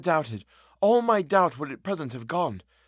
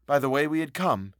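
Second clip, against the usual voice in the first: usual speaking voice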